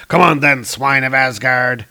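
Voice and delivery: declaiming villain, forceful on each phrase; defiant challenge. Acted. Dark elf Rotjaw challenging Thor, defiant declaiming. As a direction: throw down a bold challenge.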